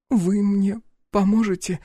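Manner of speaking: high, drawn-out plea; pleading. A narrator's reading. Frightened girl asks the man for help, pleading. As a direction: beg for help in a girl's high voice. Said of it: "уставилась на меня умоляющим взглядом".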